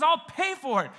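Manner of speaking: high, loud declaration; proclaiming. Acted. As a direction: ring it out like a promise. Preacher voices God paying for sin.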